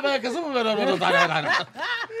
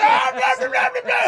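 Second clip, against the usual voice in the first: garbled shouting; 12 semitones higher, 5 dB louder than usual. Retold or imitated. Mocking garbled speech.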